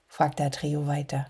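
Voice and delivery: usual speaking voice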